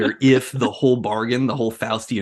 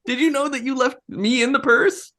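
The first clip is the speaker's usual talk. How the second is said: much higher, whiny little voice; high squeaky devil; 14 semitones higher than usual. Retold or imitated. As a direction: whine it like a hurt tiny devil.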